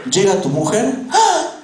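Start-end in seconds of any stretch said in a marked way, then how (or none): 1.1-1.5 high, startled cry; shocked gasp. Acted. Wife opening the door on a wrecked living room, shocked gasp. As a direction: gasp in mock horror.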